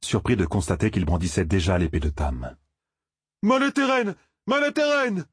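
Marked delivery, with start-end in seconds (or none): 3.4-5.2 high-pitched answering battle cry; echoed war cry. A narrator's reading. Friend echoes the young man's cry, shouting high. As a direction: echo the cry high and ringing. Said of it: "lui fit écho Perrine".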